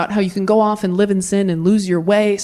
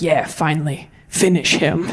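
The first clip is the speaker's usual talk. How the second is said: put-on gloating older son; 6 semitones lower than usual. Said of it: "the older son was probably like".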